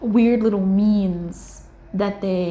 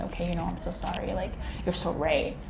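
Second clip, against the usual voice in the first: mock apology; 8 dB quieter than usual. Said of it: "and say".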